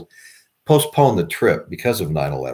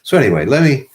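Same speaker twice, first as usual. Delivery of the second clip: ordinary talk, nothing marked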